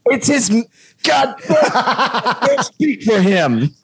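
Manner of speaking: giggling through a mock scold; laughing. Real, not acted. Retelling scolding her son, laughing through it. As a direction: break into laughs mid-protest.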